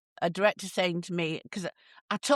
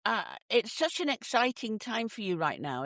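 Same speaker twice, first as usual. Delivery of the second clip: ordinary talk, nothing marked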